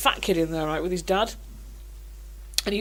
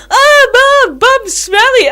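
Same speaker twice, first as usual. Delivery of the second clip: put-on much higher, loud, slow kid teasing; teasing child voice; 14 dB louder, much slower than usual. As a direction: tease like a cheeky little boy. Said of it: "he was going".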